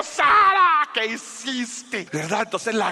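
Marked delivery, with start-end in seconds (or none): 0.0-0.9 high, loud, exasperated name call; scolding shout. Acted. Father scolds daughter once found. 0.9-2.1 slow, high reproach after a shout; drawn-out scolding. Acted. Father scolds found daughter.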